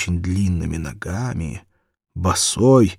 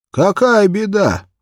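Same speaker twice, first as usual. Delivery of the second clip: lightly voiced old man; 12 semitones higher, 7 dB louder than usual. Terentiy asks.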